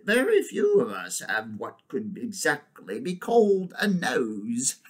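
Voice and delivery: slow, deliberate, swooping elephant voice; pondering she-elephant. Acted. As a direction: reason it out slowly, with dignity. Elephant figure in story.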